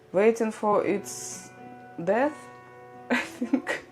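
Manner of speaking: ordinary talk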